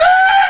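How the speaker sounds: very high, loud comic scream; hammed-up screech; mock scream. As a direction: let out a piercingly high mimicked yell. Scream ending the acted tirade.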